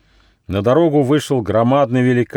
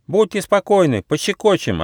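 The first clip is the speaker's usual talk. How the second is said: high-pitched mouse voice; reply readily in a high voice; confident little mouse; 10 semitones higher than usual. A narrator's reading. Mouse eagerly answers the turtle.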